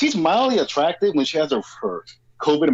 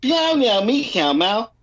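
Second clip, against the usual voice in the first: put-on baby talk; 8 semitones higher, slower than usual. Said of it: "what my mother would call baby talk".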